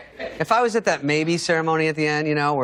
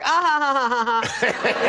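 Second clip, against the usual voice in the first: high, slow fake giggle; giggling girls; 8 semitones higher, slower than usual. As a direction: giggle like a fangirl. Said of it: "They're like".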